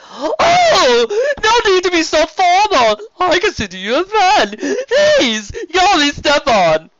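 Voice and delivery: over-the-top friendly serpent duke; flamboyant sea serpent. Acted. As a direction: gush like a warm, showy nobleman.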